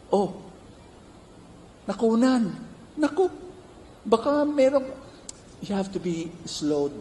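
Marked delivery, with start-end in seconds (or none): 0.1-4.8 gossipy visitor. Retold or imitated. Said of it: "say something worse".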